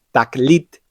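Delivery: Taklit said slowly with a throaty J sound for the Kaf; teacher modelling Taklit for students; slow clear demo. Shown as a demonstration. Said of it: "la letra Kaf se lee como una J".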